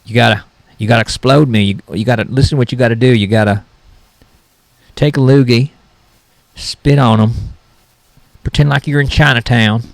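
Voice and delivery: normal talking voice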